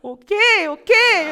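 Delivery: high, loud teary okay; imitating a crying kid. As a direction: sob out okay like a child. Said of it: "우주가 울면서". (울면서 대답하는 아이 흉내)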